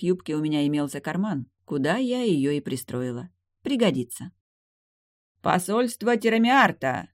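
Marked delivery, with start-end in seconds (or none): 5.4-7.1 loud, slow herald announcement; town crier. A narrator's reading. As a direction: call it out across the hall. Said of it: "громкий голос Герольда".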